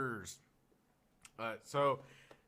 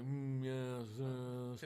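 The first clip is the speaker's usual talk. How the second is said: snotty waiter; 3 dB quieter, much slower than usual. Retold or imitated. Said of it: "All really snotty".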